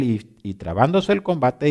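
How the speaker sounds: ordinary talk, nothing marked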